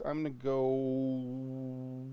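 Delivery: very slow, stretched build-up; drawn-out suspense. Acted. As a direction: drag it out before the reveal. Dramatic price drop build-up.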